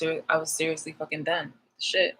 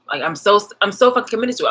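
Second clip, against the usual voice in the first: louder and higher, really worked up; fired-up rant; 5 semitones higher, 8 dB louder than usual. Real, not acted. Telling viewers how committed she was, loud and fired up. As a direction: burst out proud and fired up. Ranting about own commitment.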